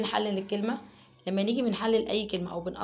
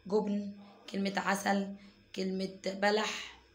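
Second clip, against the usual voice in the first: slow, careful words; much slower than usual. Shown as a demonstration. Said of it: "نرتب الكلمة دي".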